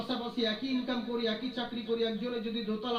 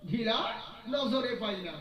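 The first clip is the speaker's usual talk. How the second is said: preaching at full force; 3 semitones higher, 6 dB louder than usual. Acted. Preacher acting out the master and disciple, full force. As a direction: hammer every line to the crowd.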